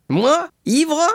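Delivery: high, tipsy protest; indignant drunkard. A narrator's reading. Caderousse denying to Danglars he is drunk, tipsy indignation. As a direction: squawk it like an offended drunk.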